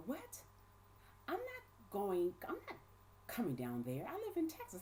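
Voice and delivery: high-pitched, disbelieving mock protest; incredulous refusal. Friend refusing the investigator's summons, incredulous. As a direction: act out a friend balking, pitch leaping.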